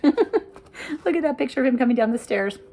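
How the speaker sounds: ordinary delivery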